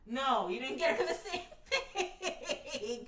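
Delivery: slow words broken by laughter; laughing. Real, not acted. To the boyfriend, laughing through the words.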